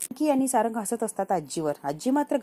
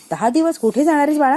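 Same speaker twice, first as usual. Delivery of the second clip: much louder, higher acted query; surprised question; 5 semitones higher, 10 dB louder than usual. Grandmother asks the child about being away ten days, loudly surprised. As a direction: pounce on the news as an eager grandmother.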